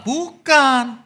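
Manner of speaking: loud, high Bukan; emphatic no. Real, not acted. Preacher rejecting duty-driven faith with a loud no. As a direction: slam the word down.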